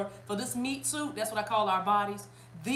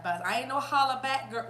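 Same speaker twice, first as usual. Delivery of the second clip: usual speaking voice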